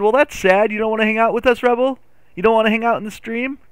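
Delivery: high-pitched plaintive questioning; mock pleading. Streamer asks a leaving viewer to stay, in high mock hurt.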